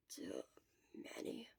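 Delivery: low whispered grumble; grumbled. Acted. Shota grumbling to Hisashi at the door, whispered. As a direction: mutter it grudgingly under your breath. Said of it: "Shota grumbled".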